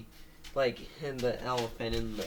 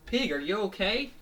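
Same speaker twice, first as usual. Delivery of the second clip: higher, louder questioning character voice; concerned cartoon elephant; 8 semitones higher, 4 dB louder than usual. Elephant checking on tired Pig, high concerned question. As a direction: ask it high, rising at the end.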